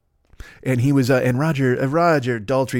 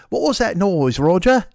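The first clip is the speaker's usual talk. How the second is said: higher mock British accent, interrupting the song; 4 semitones higher, 3 dB louder than usual. Drummer asks the singer mid-song, mock British accent. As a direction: break off with a put-on English accent.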